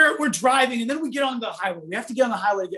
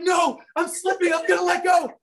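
panicked plea; 7 semitones higher than usual. Retold or imitated. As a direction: cry out as if about to fall. Friend slipping, retold in panic.

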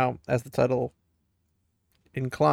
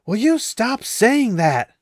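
higher, louder, slow exasperated yell; angry shout; 9 semitones higher, 8 dB louder, slower than usual. Acted. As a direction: bellow it at him, fed up. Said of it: "Rand shouted".

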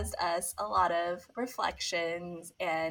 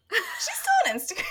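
very high, squeaky voice about to crack up; laughing disbelief; 3 dB louder than usual. Retold or imitated. Reacting to friend's forgotten hack, high laughing disbelief. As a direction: squeal it through rising laughter.

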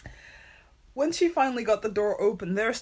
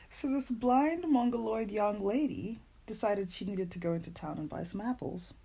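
ordinary delivery

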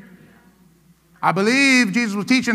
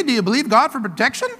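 ordinary delivery

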